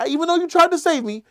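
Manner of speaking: heated brother-to-brother rant as Tommy; angry confrontation. Acted. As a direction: let him have it, voice up and loud.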